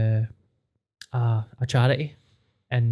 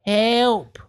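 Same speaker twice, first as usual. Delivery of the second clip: put-on washed up baby mermaid; 9 semitones higher than usual. Shown as a demonstration. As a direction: wail like a stranded baby mermaid. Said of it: "washed up baby mermaid impression".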